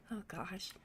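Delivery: ordinary talk, nothing marked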